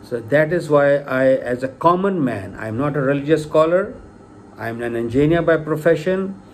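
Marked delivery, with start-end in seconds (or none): none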